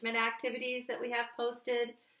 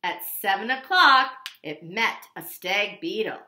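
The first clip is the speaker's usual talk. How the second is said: plain reading voice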